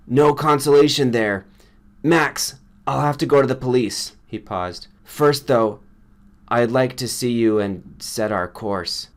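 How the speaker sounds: a shaken friend reporting a killing; upset friend Granger. A narrator's reading. Granger voiced throughout.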